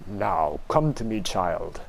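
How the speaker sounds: mysterious low voice. Acted. Mysterious voice calls the child.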